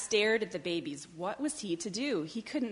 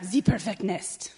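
louder mock French duck voice; French-accented cry; 5 dB louder than usual. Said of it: "she cried".

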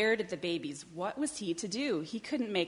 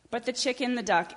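ordinary delivery, nothing marked

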